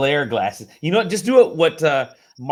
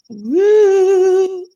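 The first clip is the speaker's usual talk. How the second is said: whoop; 11 dB louder than usual. Real, not acted. Host whoops during show intro.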